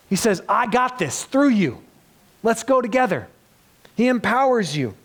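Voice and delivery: fervent sermon climax; impassioned preaching. Acted. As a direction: build it up and preach it out. Preaching builds to full force.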